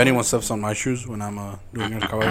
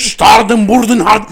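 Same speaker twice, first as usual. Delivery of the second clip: fake German gibberish; 8 semitones higher, 11 dB louder, slower than usual.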